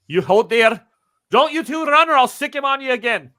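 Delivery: high, loud barked threat; threatening gunman. Acted. Gunman warns the pair not to run, loud threat. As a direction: warn them not to run.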